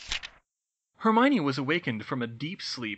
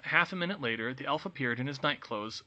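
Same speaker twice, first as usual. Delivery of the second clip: ordinary delivery, nothing marked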